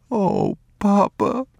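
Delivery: high, slow, mild sob; a boy's choked plea; boy sobbing to his father. Acted. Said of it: "he sobbed".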